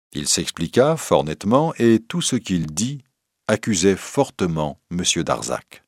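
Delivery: ordinary delivery, nothing marked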